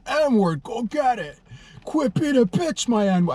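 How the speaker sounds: high-pitched mock pep talk; hard self-talk. Hyping himself up alone in the car, hard high-pitched self-talk. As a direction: goad yourself hard, voice pushed way up. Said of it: "I'm calling myself the N-word hard".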